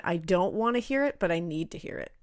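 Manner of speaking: ordinary delivery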